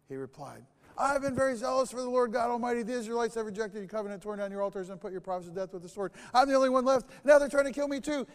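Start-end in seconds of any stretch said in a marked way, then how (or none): 1.0-6.1 rapid rattled-off complaint. Acted. Elijah's complaint rattled off again. 6.3-8.3 loud, much higher shouted refusal; furious outburst. Acted. Elijah shouting he quits.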